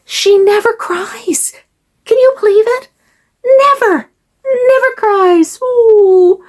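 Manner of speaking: put-on high, drawn-out cooing over a baby; baby talk. Doll owner gushing over her baby doll, high baby talk. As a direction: coo it high and slow, as to an infant.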